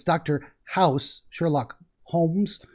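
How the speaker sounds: slow, each name set apart; slow spelled-out names. Shown as a demonstration. Host repeating the reference slowly for the listener. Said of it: "I don't know if a lot of people got that reference".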